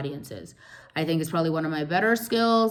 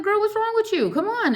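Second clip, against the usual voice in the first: much higher, calling out; calling back; 4 dB louder than usual. Retold or imitated. Retelling calling back to house girl Irene, much higher. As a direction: call back to her, pitched high.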